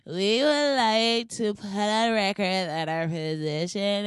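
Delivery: mock the statement like a slow, careful press briefing; Kellyanne Conway voice. Reading out H&M's second apology, a light slow Kellyanne Conway take. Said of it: "I'm reading it in my Kellyanne Conway voice".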